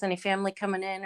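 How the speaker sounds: ordinary talk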